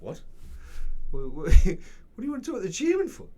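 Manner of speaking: loud, high, stammering mock disbelief; incredulous. Sales agent questioning an unusual request, mock-incredulous. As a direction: stumble over the question, imitating a baffled agent.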